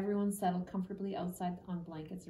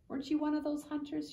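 teasing question; 8 semitones higher than usual. A narrator's reading. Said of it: "she asked".

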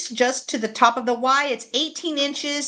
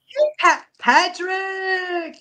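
excited greeting; 7 semitones higher, 4 dB louder than usual. Real, not acted. Greeting a viewer who joined.

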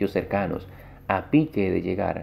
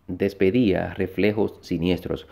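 ordinary delivery

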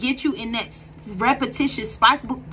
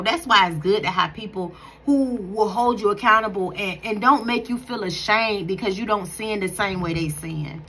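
ordinary talk

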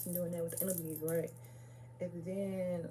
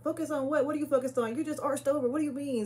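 higher, louder quick-fire comeback; retold arguing; 7 semitones higher, 5 dB louder, faster than usual. Arguing back at him for complaining about the laughing, retold heated comeback. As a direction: challenge him, imitating a heated argument. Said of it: "So we started arguing".

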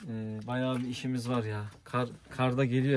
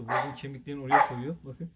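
ordinary delivery

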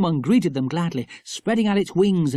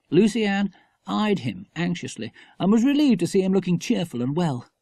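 plain reading voice

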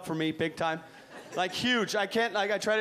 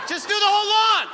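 loud mock yell; 11 semitones higher, 7 dB louder than usual. Comic yelling at his lawn sprinklers in a loud mock yell. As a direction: yell it high and loud, hammed-up.

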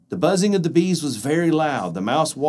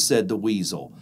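ordinary delivery

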